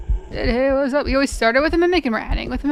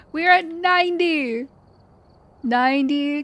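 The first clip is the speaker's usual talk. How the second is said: excited cheer; 4 semitones higher than usual. Real, not acted. Milestone cheer.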